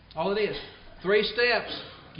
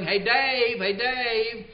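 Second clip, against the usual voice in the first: mock yell; 4 semitones higher, much slower than usual. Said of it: "that person's yelling".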